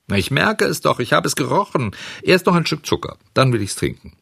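put-on high, pleading puppet-boy whine; high-pitched childish insistence; bargaining child. A narrator's reading. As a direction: bargain like a stubborn little boy.

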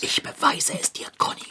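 secretive whisper. Acted. Boy whispers a promise to Conny, secretive. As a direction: promise it under your breath.

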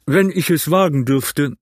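daring captain's remark; ship captain. A narrator's reading. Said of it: "sagte der Kapitän".

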